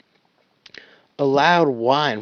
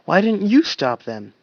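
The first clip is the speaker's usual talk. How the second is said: demanding; 7 semitones higher, 4 dB louder than usual. Acted. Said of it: "he asked".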